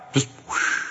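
a breathy gust of air; breathy whoosh. Acted. Preacher mimics a passing weather gust, breathy whoosh. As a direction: blow it out like wind. Imitated wind sound.

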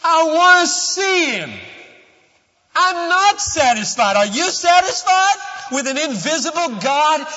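very high, very loud preacher's shout; impassioned outcry. Real, not acted. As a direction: cry it out in unsatisfied longing. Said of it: "you know what I feel like this morning?".

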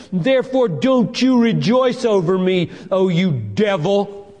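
high, loud, driving sermon crescendo; defiant pulpit proclamation; impassioned preaching. Real, not acted. Preaches at full force.

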